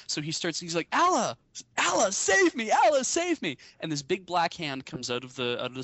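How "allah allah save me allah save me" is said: high, loud, pleading cry to the heavens; falling man shouting a desperate prayer to Allah. Acted. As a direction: scream for rescue in free fall.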